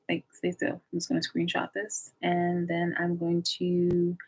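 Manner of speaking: ordinary talk, nothing marked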